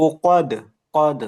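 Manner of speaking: উকাদ, কদ with heavy back qaf; firm model of উকাদ with echoing d; correct qaf. Shown as a demonstration. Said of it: "এখানেও এই যে কফ".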